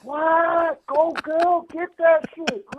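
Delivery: high, loud mock cheer for the blind lady; excited cheering. Retelling how he cheered on the blind lady, excited and loud. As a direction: cheer her on, hyped and high. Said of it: "I'm like".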